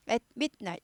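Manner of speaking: At midnight in separate pieces: at, mit, night; broken at midnight. Shown as a demonstration. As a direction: put a stop after At and inside midnight. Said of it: "이것도 끊죠".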